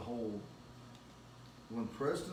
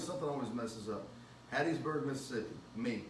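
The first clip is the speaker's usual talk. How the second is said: usual speaking voice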